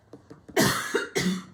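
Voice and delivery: rough, breathy cough. Real, not acted. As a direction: cough into the mic. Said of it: "Mm, sorry, guys".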